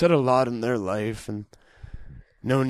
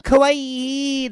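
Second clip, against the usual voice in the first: much higher, bright squeal of kawaii; Japanese girl voice; 13 semitones higher than usual. Retold or imitated. Imitating Japanese girls' speech, high squeal. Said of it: "girls are like".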